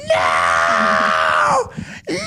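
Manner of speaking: long, drawn-out screamed no; all-out tantrum scream; retold full scream. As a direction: scream it out at full stretch, reliving the meltdown. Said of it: "and I was screaming".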